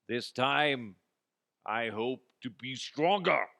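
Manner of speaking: hammed-up stage declaration; theatrical. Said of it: "like theater".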